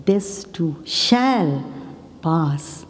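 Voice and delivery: "shall" drawn out after a slow "This too"; slow line with the weight on "shall"; stress on SHALL. Shown as a demonstration. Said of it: "emphasis on each word".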